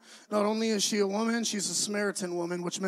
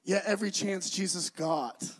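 ordinary talk, nothing marked

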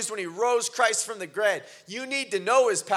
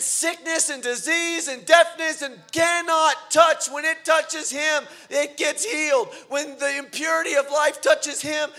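higher and louder, slow shouted preaching; preaching at full force; 8 semitones higher, 8 dB louder than usual. Acted. Preacher proclaiming Christ's power to the congregation. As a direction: proclaim it to the room.

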